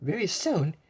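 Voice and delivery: ordinary delivery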